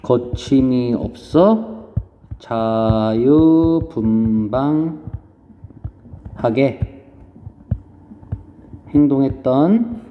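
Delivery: very slow, syllables stretched out; writing-along dictation. Real, not acted. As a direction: draw out each phrase while writing it down. Writes answer while saying it. (받아쓰듯 음절을 길게 늘여 천천히)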